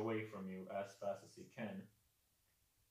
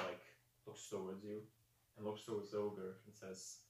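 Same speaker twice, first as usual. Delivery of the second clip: ordinary delivery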